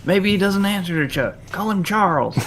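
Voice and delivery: high-pitched hammed-up suggestion; imitated movie line. Deacon advising his men, high-voiced mock exasperation. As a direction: play it as a harried movie villain. Co-host acts the favorite quote.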